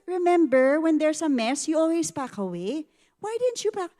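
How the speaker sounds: scolding mom. Retold or imitated. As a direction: rip into them for the mess, rising exasperation. My face was already frowning.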